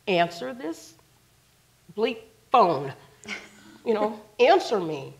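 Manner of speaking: exasperated, emphatic retold plea; mildly frantic insistence; urgent demand. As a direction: demand an answer, word by word. Retold urgent texts to him.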